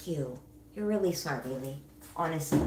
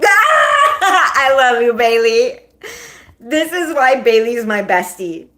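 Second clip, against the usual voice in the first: real loud, high laughing shout; 3 semitones higher, 11 dB louder, slower than usual. Real, not acted. Host cracked up by best friend Bailey's comment, laughing shout. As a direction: burst out laughing and cry it out, drawn out.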